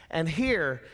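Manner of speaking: ordinary talk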